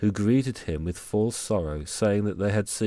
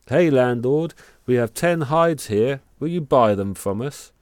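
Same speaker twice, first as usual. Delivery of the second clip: loud, high call across the pub; 5 semitones higher, 7 dB louder than usual. Acted. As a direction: hail the landlord boldly. Brothers calling out in pub.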